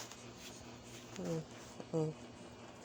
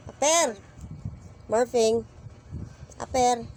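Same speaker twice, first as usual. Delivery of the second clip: much higher and louder aper; high insistent call; 4 semitones higher, 8 dB louder than usual. Real, not acted. Pressing the reluctant pet for a high five, high and loud. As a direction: urge it, bright and pushing.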